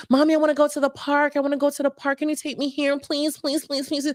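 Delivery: put-on high, fast little child pleading; pleading child voice, high and quick; whiny kid begging. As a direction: beg like a small kid who wants the park. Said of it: "your kids will come to you like".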